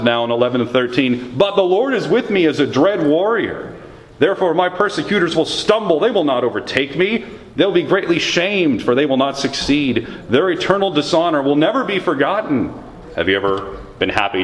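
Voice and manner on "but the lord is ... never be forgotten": higher, impassioned reading of the lament; lamenting scripture reading. Acted. Pastor reads Jeremiah's private lament to the Lord, impassioned. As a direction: pour out the complaint as you read.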